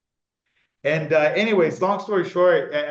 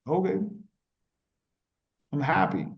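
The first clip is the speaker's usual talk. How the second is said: normal talking voice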